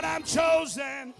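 usual speaking voice

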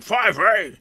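high, loud mock-clueless question; clerk sballato. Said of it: "just all fucked up".

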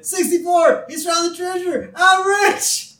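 very high, loud gleeful shout; hammed-up jubilation. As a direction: shriek it in mock triumph. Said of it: "full range of emotions right there".

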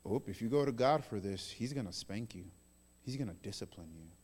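low voice dropping to a hush; religious spirit warning. Acted. Religious spirit to believer, in a hushed warning. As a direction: confide a warning, soft and low. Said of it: "The religious spirit will tell you".

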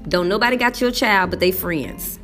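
usual speaking voice

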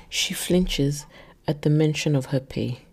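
ordinary delivery, nothing marked

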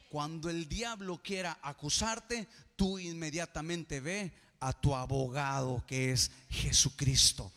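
preacher roaring to the congregation; fiery preaching climax. Acted. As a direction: shout the promise to the congregation. Preaching climax at full force.

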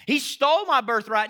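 loud aggrieved mock complaint; he stole my birthright, whined; mock victim whine. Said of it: "revise history once we feel victims".

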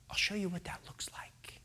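whispered reply; very soft, understated answer; quiet assurance. Acted. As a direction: drop to a hushed near-whisper. Jesus' quiet answer to Caesar.

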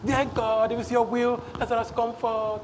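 soft, mock-meek praying; timid prayer. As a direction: imitate a hesitant, polite prayer. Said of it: "It was not".

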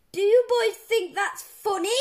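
loud, high indignant question; angry yell. A narrator's reading. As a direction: scold the boys at full volume. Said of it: "yelled Mister Crump".

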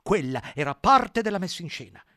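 shouting. Acted. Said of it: "gridò Squillo".

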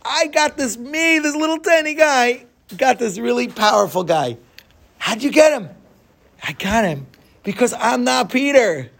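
triumphant glee. Acted. Little guy telling the crowd the trick, triumphant glee.

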